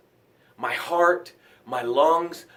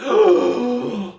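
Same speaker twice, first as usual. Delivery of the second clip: big gasp like breaking the surface; acted gasp for air; 4 semitones higher than usual. Drowning swimmer surfacing, one sharp gasp. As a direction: gulp air as if just pulled from the water.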